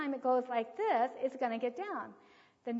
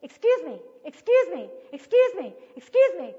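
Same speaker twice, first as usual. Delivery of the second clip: loud, high, repeated interruption; high, pestering child demanding attention; imitating insistent child; 5 semitones higher, 13 dB louder than usual. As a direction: pipe up loudly, each repeat just as insistent. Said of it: "he sat there and says".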